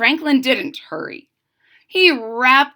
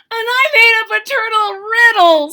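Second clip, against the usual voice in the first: much higher, louder fox; 12 semitones higher, 7 dB louder than usual. Acted. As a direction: chirp it out eagerly. Fox voiced.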